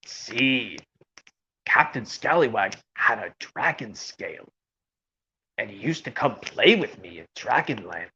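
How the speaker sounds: low, soft character voice; cartoon storyteller. Old friend explaining how Captain Scallywag used to play, reminiscing. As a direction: recall it in a low, quiet voice. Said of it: "And also like this".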